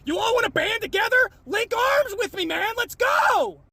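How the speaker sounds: high, fired-up call to arms; rallying shout. Real, not acted. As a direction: rouse the crowd at full voice. Rallying followers.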